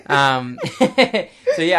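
high laughing after a bit. Real, not acted. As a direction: giggle through the words. Laughs after doing the accent.

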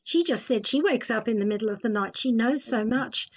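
ordinary talk

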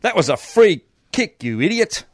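high, loud mock yell at the ref; acted insult. Footy player abusing the umpire, high mock yell. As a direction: snap it at the umpire like an angry player. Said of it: "response B".

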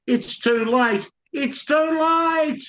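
high, slow, drawn-out wail; anguished wail. Acted. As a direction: wail it in despair, stretching the words. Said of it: "George continued".

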